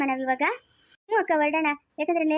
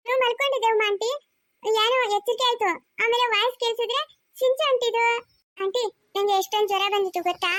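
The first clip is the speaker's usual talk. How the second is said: put-on chatty small child recounting a fever; little girl voice; 6 semitones higher than usual. Child character telling of illness.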